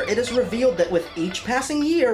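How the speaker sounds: normal talking voice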